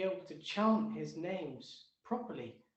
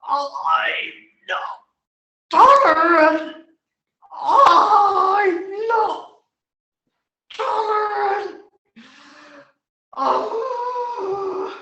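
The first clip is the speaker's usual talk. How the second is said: much louder and higher raging tree voice; angry tree shouting; 9 semitones higher, 18 dB louder, much slower than usual. Acted. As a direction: bellow it as a furious ancient tree.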